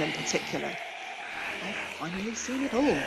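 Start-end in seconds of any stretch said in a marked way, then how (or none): 1.7-3.0 quiet inner thought; thinking aloud. A narrator's reading. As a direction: murmur it to yourself. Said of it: "he thought".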